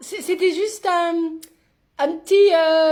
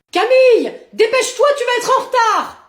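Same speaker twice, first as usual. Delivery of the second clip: raised, impatient parental call; 4 semitones higher, 5 dB louder than usual. Acted. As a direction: call the child down, louder and higher. Mother calls child late for school. (appel fort et impatient)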